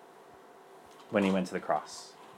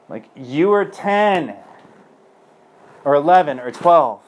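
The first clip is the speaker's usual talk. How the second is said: high, loud and slow, stressing each age; incredulous emphasis; 5 semitones higher, 9 dB louder, slower than usual. Real, not acted. Speaker to audience about the boy, slow loud disbelief.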